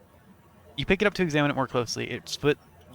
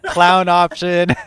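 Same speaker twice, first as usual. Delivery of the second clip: laughing; 11 semitones higher, 10 dB louder, much slower than usual. Real, not acted. Laughing at silly choice.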